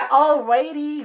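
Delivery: loud, high mock Hawaiian accent; jokey, belted Hawaiian accent. Shown as a demonstration. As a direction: ham up a Hawaiian accent for laughs. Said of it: "nawawala na yung Hawaiian accent mo".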